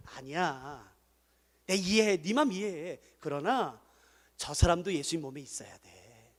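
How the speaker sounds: calm a hurt friend down softly; soothing. Acted. Pastor showing how to soothe a complaining church member, softly. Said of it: "그때 다독여주세요".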